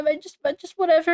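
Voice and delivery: normal talking voice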